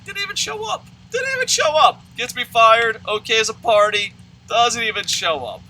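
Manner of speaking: high, loud mock rant; indignant outburst. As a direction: shout your disbelief at the no-show. Retold rant about absent woman.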